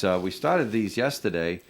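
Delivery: normal talking voice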